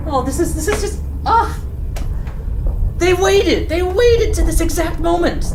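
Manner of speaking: bitter at being outplayed; furious shopkeeper NPC; upset NPC. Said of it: "He's upset".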